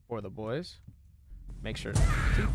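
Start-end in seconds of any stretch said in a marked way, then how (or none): none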